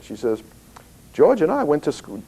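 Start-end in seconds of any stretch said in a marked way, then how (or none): 1.1-2.2 put-on an old woman's Down East Maine accent, pitched up; lightly voiced Down East Maine caller. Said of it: "she has a very down east accent".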